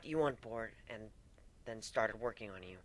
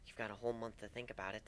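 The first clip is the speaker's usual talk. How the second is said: usual speaking voice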